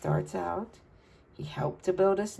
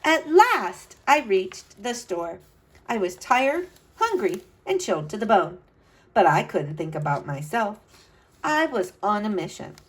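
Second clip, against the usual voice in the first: put-on bright, lively read-aloud to kids; storybook narration; 5 dB louder than usual. A narrator's reading. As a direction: tell it like a storyteller for children. Reads picture book aloud.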